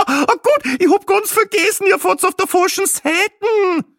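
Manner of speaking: very high, loud, giggly Austrian wife; hysterical Austrian lady. A narrator's reading. Austrian tourist laughs at left-hand driving, shrill high. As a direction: shriek it through laughter. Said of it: "ein geradezu hysterisches Lachen".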